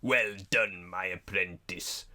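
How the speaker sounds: low, slow sinister emperor; cold rasping evil emperor. Retold or imitated. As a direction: purr it like a scheming Sith. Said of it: "Palpatine goes".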